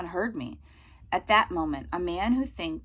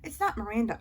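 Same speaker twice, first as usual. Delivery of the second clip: usual speaking voice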